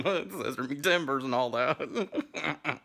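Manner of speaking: rising, slowed words through laughter; laughing. Real, not acted. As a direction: crack up as the phrase ends. Cracks up at own innuendo.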